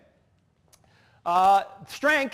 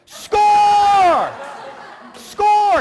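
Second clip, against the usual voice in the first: loud, high celebratory shout; acted goal cheer; 13 semitones higher, 10 dB louder than usual. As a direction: yell it out in mock triumph. Mimicking celebration of own goal.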